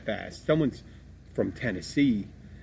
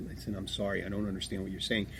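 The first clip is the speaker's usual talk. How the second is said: muttered apologetic aside, much softer and quick; quiet apology; 4 semitones lower, 9 dB quieter than usual. Retold or imitated. Host retelling how he apologizes to a customer, quietly and quickly. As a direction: drop the voice and hurry an embarrassed apology.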